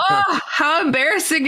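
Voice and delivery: high, sheepish exclamation; embarrassed surprise. Retold or imitated. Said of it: "I'm like".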